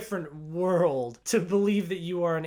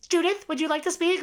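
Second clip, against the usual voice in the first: high squawky parrot parroting the line back; girl who thinks she's a parrot echoing the nurse; parrot mimicry; 12 semitones higher, 4 dB louder, faster than usual. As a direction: repeat it like a parrot would. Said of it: "she thinks she's a parrot".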